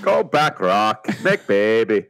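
a lightly put-on Northern English accent, imitating Sean Bean; Sean Bean again. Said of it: "it's how he says it".

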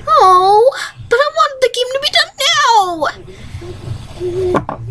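put-on high, fast whining girl voice; whiny Midori. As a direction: whine it up high like a spoiled fan. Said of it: "Okay, [name]".

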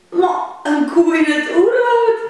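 high, loud astonished calf; surprised calf. Acted. Calf amazed at its mother's jungle plan, high and surprised. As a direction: gasp it out in high disbelief. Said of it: "zegt het kalf verbaasd".

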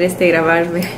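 ordinary talk, nothing marked

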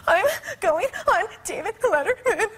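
high, gasping wail, hammed up; mock breathless sobbing. Sobbing passenger answers her seatmate, in mock breathless wails. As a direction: blubber it out between exaggerated gasps. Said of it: "'Cause I can't breathe".

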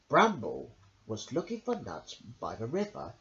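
usual speaking voice

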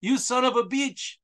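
mocking Delavina screaming at Garrett in a fake accent; mock furious scream. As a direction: ham up a woman screaming curses. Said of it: "screams at him".

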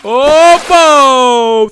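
high loud cheer; loud gleeful shout. Acted. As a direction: shout it with glee. Flushing the toilet.

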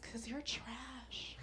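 slow, hushed whispered insult. Pretend. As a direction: whisper it like a mean secret. Mock magazine insult whispered.

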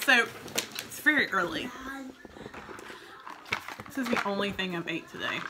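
talking through a mouthful; words round a bite of food; chewing while talking. Real, not acted. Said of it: "I'm chewing with my mouth open".

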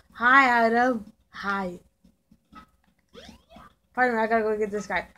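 normal talking voice

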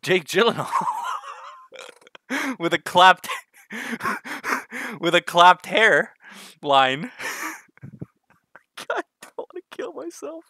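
cracking up, voice squeezed high; helpless giggles breaking up the words; laughing too much. Real, not acted. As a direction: try to get it out through real laughter. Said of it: "I'm laughing way too much".

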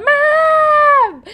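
high loud call for mom; childhood yell for mom. Retold or imitated. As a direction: scream for mom from the bedroom. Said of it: "be like".

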